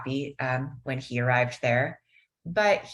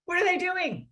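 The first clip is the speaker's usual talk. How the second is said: high exasperated disbelief; mock exasperated outburst; 12 semitones higher than usual. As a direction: retell his near-explosion of anger, hammed up a bit. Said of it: "He almost explodes in anger".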